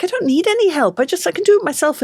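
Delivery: high, insistent protest; indignant. Retold or imitated. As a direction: insist you can do it yourself. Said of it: "And I'm like".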